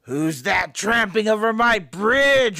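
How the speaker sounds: roaring troll. A narrator's reading. Troll challenges the big goat on his bridge, roaring. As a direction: bellow the challenge as an angry troll. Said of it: "roared the troll".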